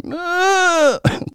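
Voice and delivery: raspy failed monster roar; sick throat roar breaking into a cough; coughing fit. Real, not acted. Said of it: "Godzilla with covid".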